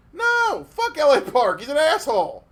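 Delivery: high, loud, emphatic curse; fired-up rant; loud outburst. Real, not acted. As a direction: blurt it out hard and loud. Angry outburst at a wrestler.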